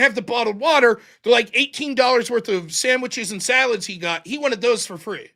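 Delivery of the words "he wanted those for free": normal talking voice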